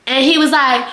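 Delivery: usual speaking voice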